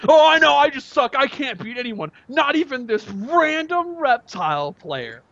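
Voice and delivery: high, mock self-pitying outburst; imitated meltdown. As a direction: mimic a sore loser losing it, high-pitched. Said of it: "he loses it. He's like".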